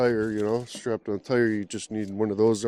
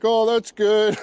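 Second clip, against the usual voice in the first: mocking clueless guy; 10 semitones higher, 7 dB louder, slower than usual. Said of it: "they'll be like".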